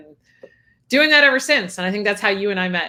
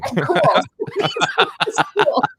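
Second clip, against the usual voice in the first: high laugh through the words; 14 semitones higher, 7 dB louder than usual. Real, not acted. Guest laughing with host about early Facebook, laughing. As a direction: laugh it out, higher.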